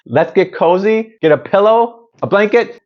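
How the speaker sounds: cheerful host rallying viewers for a long movie, excited; excited invite. Real, not acted. Host hyping cozy movie night.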